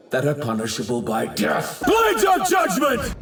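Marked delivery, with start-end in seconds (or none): 1.9-3.2 very high screamed attack name; attack call. Acted. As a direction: scream it as you strike. Calling out an attack.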